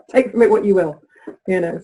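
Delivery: usual speaking voice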